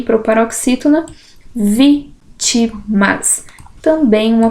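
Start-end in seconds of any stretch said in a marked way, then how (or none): 1.5-3.4 "Vítimas" very slow, syllables apart. Shown as a demonstration. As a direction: dictate "Vítimas" as three separate syllables. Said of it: "Separação de sílabas".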